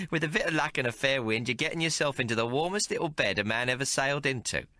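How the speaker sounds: a streetwise barmaid teasing the hero; Maggie's voice. Acted. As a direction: play her worldly and mocking. Maggie flirts.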